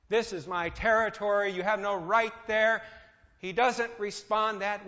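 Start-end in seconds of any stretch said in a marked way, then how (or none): 0.1-2.8 louder, a mock defiant claim; defiant demand. God demanding his rights from Satan, mock defiant. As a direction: stake your territory, half-hearted and defiant. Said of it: "with a defiant attitude and demanding his rights".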